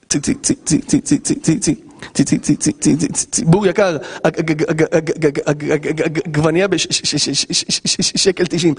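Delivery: stuck on צי, עג- and ש- again and again before the words come; imitating a stutter. Preacher imitating a stuttering supermarket announcer, repeated syllables. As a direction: mimic a stammering cashier straining to get it out. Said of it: "רבע שעה לוקח לו להגיד".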